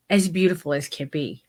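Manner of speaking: ordinary talk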